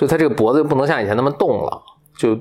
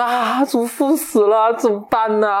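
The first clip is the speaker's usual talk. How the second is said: put-on much higher, slow, aghast girl's cry; mock horror; 13 semitones higher, slower than usual. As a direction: gasp out the news in alarm. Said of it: "牧羊女又大惊失色".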